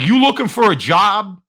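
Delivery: high, punchy New Jersey dockworker impression; put-on New Jersey question; New Jersey accent. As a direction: challenge them like a New Jersey tough. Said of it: "it was Tony from the docks".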